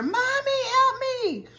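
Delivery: put-on high, slow little-boy plea from far off; pitched-way-up distant child voice; child crying for help. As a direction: call out for mommy from inside the toilet. Boy stuck in porta potty.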